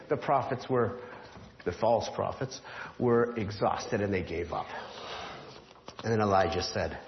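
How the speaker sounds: ordinary talk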